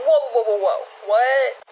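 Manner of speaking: mildly hammed-up "hold on" reaction; mock disbelief. Acting out the viewer about to object, mock disbelief. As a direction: imitate a startled doubter. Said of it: "before you're like".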